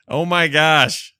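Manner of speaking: drawn out in stunned delight; amazed. Real, not acted. Host stunned by the clip, slow amazed gasp.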